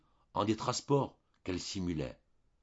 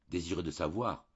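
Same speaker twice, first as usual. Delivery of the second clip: plain reading voice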